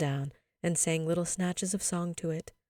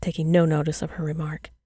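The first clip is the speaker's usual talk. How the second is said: ordinary delivery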